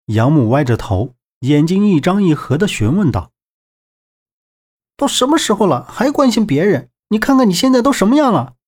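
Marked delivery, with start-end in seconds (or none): 5.0-8.6 high-pitched, exasperated telling-off; cursing scolding. A narrator's reading. 周震 scolding wounded 杨木 for worrying about others, exasperated. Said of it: "周震一旁骂咧道".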